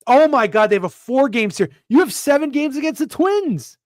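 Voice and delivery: loud, high-pitched shock at the schedule; excited disbelief. Real, not acted. Host reading schedule to co-host, loud excited disbelief. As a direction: pitch it up and out in amazement.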